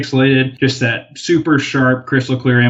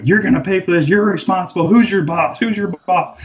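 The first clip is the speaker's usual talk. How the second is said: imitated irate man, pitched up; mock angry demands; 7 semitones higher than usual. Van owner face to face with park worker, mock angry demands. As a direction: raise the pitch and demand answers like a riled man.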